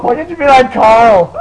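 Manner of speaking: loud, high, exasperated parental yell; mock scolding dad. Host plays dad comparing son to Carl, mock scolding shout. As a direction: berate the kid in a hammed-up shout.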